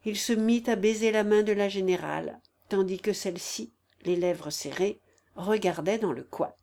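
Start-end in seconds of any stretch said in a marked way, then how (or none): none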